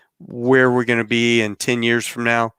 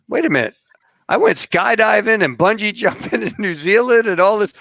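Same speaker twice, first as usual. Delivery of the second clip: high-pitched mock protest; indignant wife; 9 semitones higher, 3 dB louder than usual. Pregnant wife protests bridge refusal, acted indignation. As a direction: imitate an outraged wife, voice jumping up.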